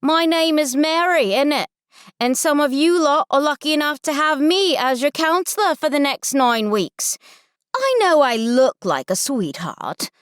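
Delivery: high, forceful British counselor; heavy British accent. Acted. Counselor introducing herself to campers, heavy British accent. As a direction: address the crowd in broad British English. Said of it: "she says in a heavy British accent".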